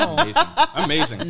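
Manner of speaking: high, delighted laughing praise. Real, not acted. Host reacting to the writer's blunt nope, laughing.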